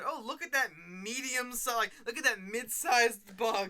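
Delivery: slow mocking quote. Mocking the show's narrator.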